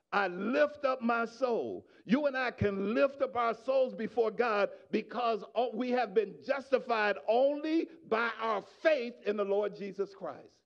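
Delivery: ordinary talk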